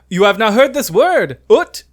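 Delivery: high, loud mock French exasperation; annoyed French accent. Pharmacist to baffled tourist, annoyed imitated French accent. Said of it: "he's, like, so annoyed".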